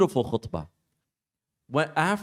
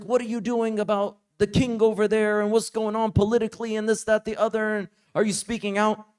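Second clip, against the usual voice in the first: pushy questioner; 7 semitones higher than usual. Retold or imitated. Heckler confronting visiting scholar.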